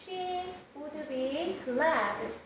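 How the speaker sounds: soft, drawn-out example sentence; slow dictation. Shown as a demonstration. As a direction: spell it out slowly for the class. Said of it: "그러면 생각해봐야 돼요".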